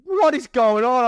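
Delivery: high, loud, slow put-on voice of the missus; imitating the wife. Wife comes out asking what's going on, high mock voice. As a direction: do the wife coming out, baffled. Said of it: "She's like".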